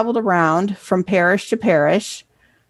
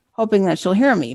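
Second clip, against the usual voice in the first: ordinary delivery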